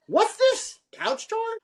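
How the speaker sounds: very high, squeaky disbelief, rising at the end; a baffled bride squeaking a question; puzzled bride. Retold or imitated. Bride asks the photographer about the stream, squeaky and puzzled. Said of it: "And she goes".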